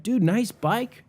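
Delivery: eager neighbor. Retold or imitated. Neighbor's eager greeting imitated.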